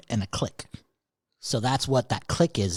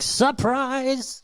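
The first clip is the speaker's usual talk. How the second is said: high, drawn-out jack-in-the-box announcement; mock surprise; 12 semitones higher than usual. Joking as a jack-in-the-box, mock surprise. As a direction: pop out with a big high-pitched reveal.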